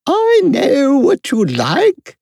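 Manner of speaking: very high, slow royal voice; good-natured Queen. A narrator's reading. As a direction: indulgent queen, drawn out high. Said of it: "the Queen said good-naturedly".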